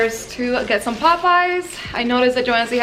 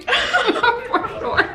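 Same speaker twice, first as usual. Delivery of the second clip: laughing; 13 semitones higher than usual. Real, not acted. Laughing while answering.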